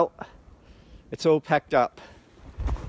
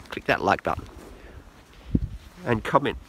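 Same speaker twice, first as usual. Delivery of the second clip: normal talking voice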